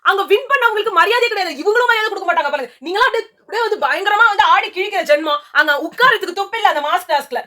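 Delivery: high-pitched indignant tirade; worked-up scolding in a high voice; heated rant. Real, not acted.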